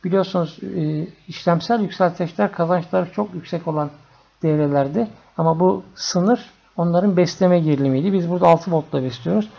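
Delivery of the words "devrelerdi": usual speaking voice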